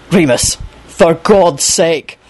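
slow, weighted plea with menace; pleading and threatening. Acted. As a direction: plead through a veiled threat. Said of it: "sound both pleading and threatening".

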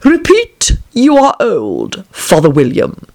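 slow, drawn-out caterpillar order; commanding caterpillar. A narrator's reading. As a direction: dictate the title word by word, starting high.

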